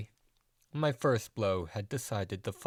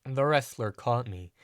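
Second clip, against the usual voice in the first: ordinary delivery, nothing marked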